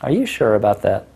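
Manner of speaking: teasing disbelief; mock disbelief. Shown as a demonstration. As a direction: doubt her playfully. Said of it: "you're gonna go like".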